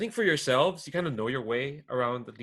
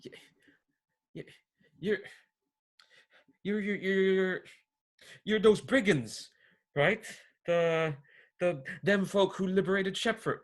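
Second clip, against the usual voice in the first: nervous bluster; 8 semitones higher, 6 dB louder than usual. Acted. Frightened youth blusters a threat.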